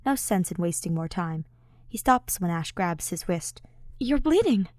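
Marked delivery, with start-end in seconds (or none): 4.0-4.7 higher, louder worried voice; high worried friend; Ash alarmed. Acted. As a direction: point it out with alarm.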